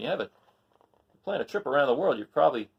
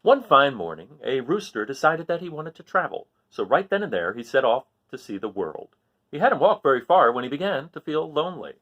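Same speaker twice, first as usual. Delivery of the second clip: lively read-aloud storytelling; story narration; 3 semitones higher, 9 dB louder than usual. A narrator's reading. Teacher reading a story to the class, lively narration. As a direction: read it like a picture book to kids. Reads a picture book aloud.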